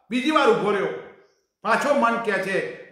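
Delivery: normal talking voice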